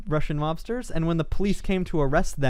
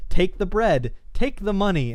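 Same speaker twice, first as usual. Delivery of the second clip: simple commands; 6 semitones higher, 3 dB louder than usual. Retold or imitated. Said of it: "things like".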